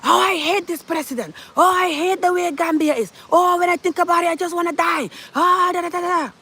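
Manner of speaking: high-pitched mock whine of moaning critics; mimicking angry Gambians in a high whiny voice; mocking angry commenters. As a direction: parody the complainers, pitch way up. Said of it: "a lot of them so angry".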